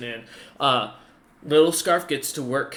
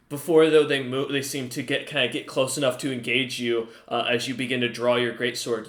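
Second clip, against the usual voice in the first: usual speaking voice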